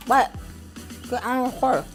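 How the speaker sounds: ordinary delivery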